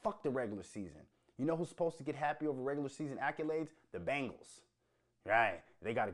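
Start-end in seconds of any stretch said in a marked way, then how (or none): none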